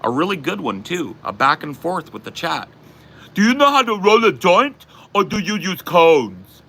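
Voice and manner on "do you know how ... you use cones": loud, high-pitched mimicry of a clueless dude; mock someone butting in, loud and high; mocking imitation. Imitating someone interrupting a street conversation, mocking and loud.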